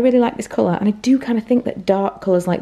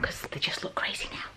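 whispering; 14 dB quieter than usual. Real, not acted. Quiet aside to camera.

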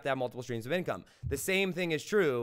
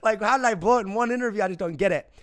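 retold panic; 4 semitones higher, 5 dB louder than usual. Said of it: "My heart is racing at a million miles an hour".

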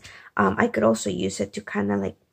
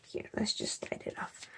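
soft working whisper; 14 dB quieter than usual. Real, not acted. Sliding open a gift at night, whisper. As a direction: murmur it under your breath. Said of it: "I've been a little bit quiet".

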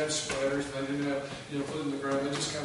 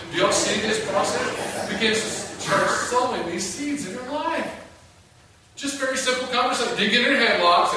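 louder and higher, pressing each point home; full-force preaching; 8 semitones higher, 10 dB louder than usual. Acted. Pastor driving the point to his congregation, full-force preaching.

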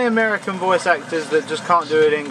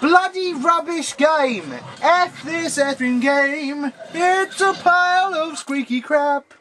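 slow rant; 7 semitones higher, slower than usual. Real, not acted. Raging after dying again.

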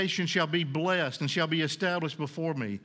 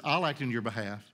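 God speaking tenderly; 6 semitones lower than usual. Acted. God's voice, low reassurance.

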